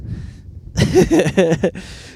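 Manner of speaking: real chuckle at own remark. Real, not acted. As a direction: run of short, amused laughs. Laughs at own crude remark.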